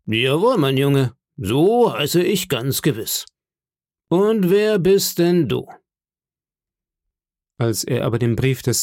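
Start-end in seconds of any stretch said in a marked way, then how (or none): 0.1-5.7 higher-pitched, drawn-out sailor landlord; Silver, hearty innkeeper. Acted. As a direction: greet the boy as a jovial one-legged seadog.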